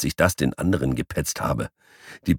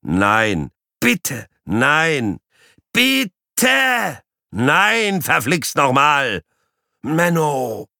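high, whining protest; whiny pleading cat; 9 semitones higher, 3 dB louder, much slower than usual. Acted. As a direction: beg him to stop, whining. Cat pleading with the guinea pig.

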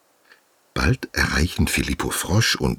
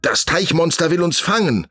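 panicked hedgehog; 14 semitones higher, 3 dB louder than usual. Acted. Hedgehog still fleeing.

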